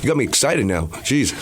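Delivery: usual speaking voice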